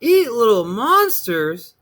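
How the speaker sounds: put-on high, slow astonished child; boy amazed at the idea; incredulous. A narrator's reading.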